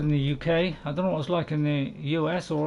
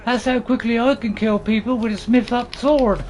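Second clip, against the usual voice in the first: raised, declaiming put-on heroine voice; Lady Eowyn; 4 semitones higher, 4 dB louder than usual. Lady Eowyn boasting of kills, high and declaimed. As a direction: boast loudly in a high character voice. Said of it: "My best Eowyn voice".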